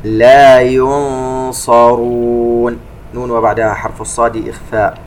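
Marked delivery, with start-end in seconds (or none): 0.0-2.8 slow, melodic tajweed chant; measured Quranic recitation. Shown as a demonstration. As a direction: recite slowly with held nasal sounds. Recites verse segment.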